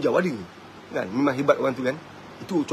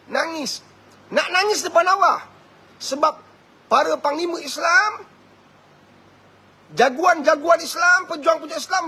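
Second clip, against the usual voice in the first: high, impassioned sermon voice, pitched well up; preaching at full force; 7 semitones higher than usual. Acted. Preacher urging men to cry before Allah, impassioned high voice. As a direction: plead with them to weep before God.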